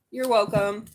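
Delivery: usual speaking voice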